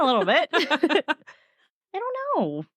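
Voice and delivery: squeaky high laughing; giggling shrug. Real, not acted. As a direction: let the laugh push it up high. Laughing at ghost pillows.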